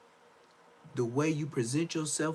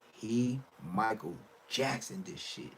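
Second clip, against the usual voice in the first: slow, softer, broken into separate pieces; slow emphatic repeat; 3 semitones lower, 7 dB quieter than usual. Real, not acted. Repeating the verdict on his new voice, slow and deliberate. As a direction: spell it out slowly, pausing between words.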